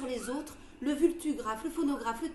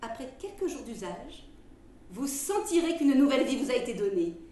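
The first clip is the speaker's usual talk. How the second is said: ad read; 2 semitones higher, 5 dB louder than usual. Acted. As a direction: sell it like an old radio ad. Reads a quack remedy advertisement.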